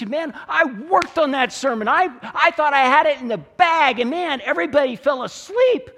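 loud, high mock lament; hammed-up exasperation. Preacher lamenting a sermon that flopped, mock exasperated. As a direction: build to a loud, comic protest.

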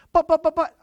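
high, loud pleading; protesting child. Acted. Child trying to explain to an angry parent, high and pleading. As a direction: squeak out protests.

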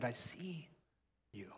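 soft, low, compassionate voice; quiet offer of help; gentle intimacy. Acted. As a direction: speak tenderly to one person up close. Jesus voiced close to blind man.

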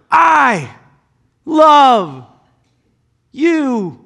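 slow, emphatic word-by-word; God speaking. Acted. God's closing promise to humanity, emphatic. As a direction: let each word ring out. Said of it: "God made an emphatic stamp".

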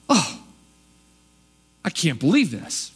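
scandalised, put-out disbelief; indignant astonishment. Shown as a demonstration. Preacher demoing the hometown's offended shock, indignant. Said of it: "that indignant astonished".

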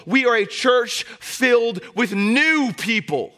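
high, loud preacher's cry; full-force preaching. Acted. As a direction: preach it out to the whole church.

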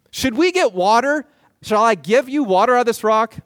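pressing the people with a rhetorical question; rhetorical challenge. Retold or imitated. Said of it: "rhetorical question".

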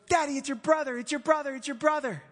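put-on high, loud excited child voice; excited little girl. Daughter spotting Pastor June on stage, excited child. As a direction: squeal it like a thrilled daughter. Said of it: "she was so excited".

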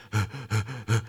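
short wheezy puffs of breath; imitated sheep breathing. Preacher mimicking the old sheep Herman, audible breathing. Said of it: "you could hear him breathing".

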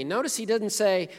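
ordinary delivery

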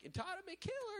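very high sing-song taunt; teasing yell. Retold or imitated. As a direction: squeak the nickname like a tease. People yelling teasingly.

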